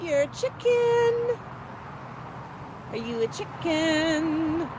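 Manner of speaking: high, slow, drawn-out cooing; playful pet talk. Pretend. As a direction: stretch it out high to a pet bird. Teasing the bird in pet talk.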